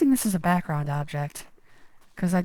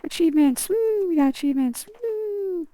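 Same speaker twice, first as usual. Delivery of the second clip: high, loud whooping celebration; excited cheering; 6 dB louder, slower than usual. Real, not acted. Cheering over unlocked achievements.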